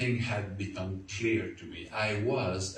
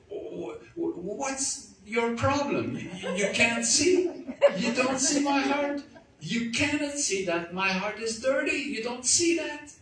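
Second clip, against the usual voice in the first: much higher, louder, panicked pleading; 13 semitones higher, 3 dB louder than usual. Retold or imitated. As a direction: cry out in panic at him. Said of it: "I kind of panicked and said".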